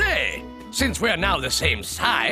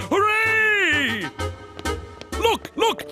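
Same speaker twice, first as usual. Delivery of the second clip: excited cheer; 6 semitones higher, much slower than usual. Acted. Joy at size back.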